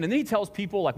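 ordinary delivery

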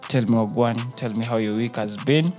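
ordinary delivery

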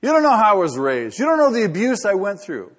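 hammed-up self-pitying protest; whining excuse maker. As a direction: act out the defensive griper. Imitating an excuse-making congregant.

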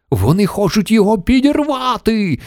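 high-pitched panicked cry; yelling. A narrator's reading. Mission Control fears the hotel will be blown up, yelling. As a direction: yell it in alarm. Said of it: "заволав центр керування".

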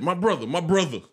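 high mock "my brother" greeting; put-on eager stranger calling out; lightly voiced overfriendly stranger; imitating the stranger. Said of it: "And he said".

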